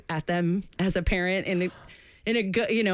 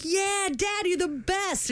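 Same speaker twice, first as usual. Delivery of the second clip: put-on much higher, gushing kid voice; excited kid; 11 semitones higher, slower than usual. Son thanking his dad for the tape, gushing high. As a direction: squeal it like a thrilled young boy. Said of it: "He's like".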